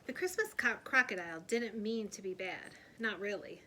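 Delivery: ordinary delivery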